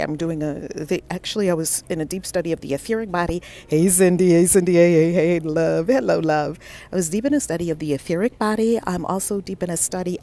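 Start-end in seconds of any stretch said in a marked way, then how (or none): 3.7-6.6 calling out loudly to a friend; loud excited greeting. Real, not acted. Host greeting viewer Cindy in chat, loud and happy. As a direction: shout a happy hello across the room.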